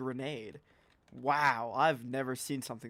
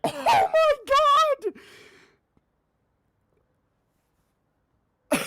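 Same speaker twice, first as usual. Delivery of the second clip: laughing shriek; 10 dB louder than usual. Real, not acted. Laughing disbelief after the grenade.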